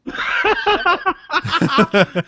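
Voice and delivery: laughing while saying it. Real, not acted. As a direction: laugh through the word. Mocking the mispronunciation, laughing.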